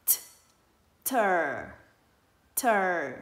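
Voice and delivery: "T" released from behind the upper teeth, then "ter"; bật âm t. Shown as a demonstration. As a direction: pop the "T", then a loud falling "ter". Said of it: "Mình sẽ đặt lưỡi ở sau hàm răng trên". (đặt lưỡi sau răng trên, bật "T" rồi "ter")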